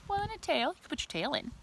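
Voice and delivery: usual speaking voice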